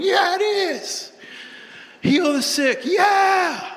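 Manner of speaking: high, loud hyped-up team yelling back; fired-up team. Retold or imitated. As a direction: pump them up like a roaring locker room. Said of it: "you can see the team going".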